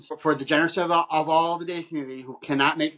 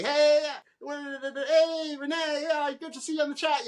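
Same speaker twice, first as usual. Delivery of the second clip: high, upbeat voice of a fan typing in the stream chat; chatty fan voice; 12 semitones higher, slower than usual. Retold or imitated. Podcast guest mimics viewers greeting each other in the chat, high and chirpy. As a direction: bounce it up high like a friendly chat regular. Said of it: "chatting away".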